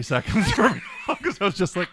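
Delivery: laughing through the words; giggling while telling it. Real, not acted. Laughing retelling of fainting.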